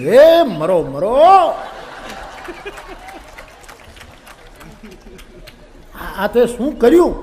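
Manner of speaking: a hammed-up cry, building to a shout; guru's cry of pain. A narrator's reading. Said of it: "બાપુએ રાડ નાખ્યો". (ગુરુની દર્દભરી ચીસ, હસતાં હસતાં)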